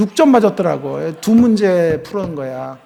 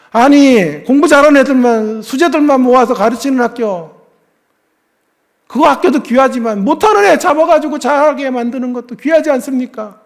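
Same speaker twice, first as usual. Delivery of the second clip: raised, higher pleading voice; tearful pleading; 7 semitones higher, 6 dB louder than usual. Retold or imitated. Father pleading with the principal for his daughter, loud and high. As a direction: beg the principal loudly, voice lifted. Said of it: "내가 펑펑 울면서".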